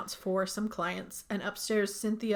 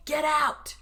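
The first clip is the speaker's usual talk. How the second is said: higher, punched-out command; loud voice; 7 semitones higher, 4 dB louder than usual. Retold or imitated. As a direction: imitate a sudden loud voice. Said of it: "this loud voice say".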